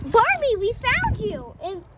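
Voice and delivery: plain reading voice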